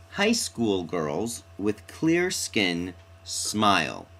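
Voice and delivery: very slow, each S hissed in school, skin, smile; slow consonance demo. Shown as a demonstration. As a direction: draw out the S sounds slowly. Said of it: "if you look at this example".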